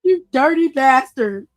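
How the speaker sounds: loud, playful indignant yell; mock outrage. Real, not acted. Host yelling at a chatter who called him a name, playfully outraged. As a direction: bark it at the chatter in fake offense.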